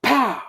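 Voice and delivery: loud pow; punch sound effect. A narrator's reading. Storyteller acting out the second punch, loud pow. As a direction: punch the word like a blow.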